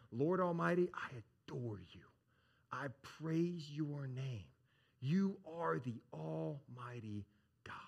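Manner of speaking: hushed worshipful prayer, slowing down; reverent adoration. Acted. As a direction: let the praise sink towards a slow, breathed whisper. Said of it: "And so they're saying".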